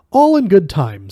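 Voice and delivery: smooth, polite waiter voice; courteous restaurant waiter. Acted. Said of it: "he said".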